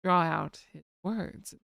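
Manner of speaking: low, slow imitation with long pauses; drawn-out slow speech. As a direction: stretch the words out, deep and unhurried. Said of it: "He does have a tendency to".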